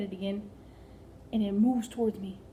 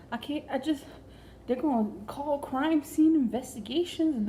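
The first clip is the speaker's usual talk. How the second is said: panicked husband; 5 semitones higher than usual. Retold or imitated. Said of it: "I hear him on the phone".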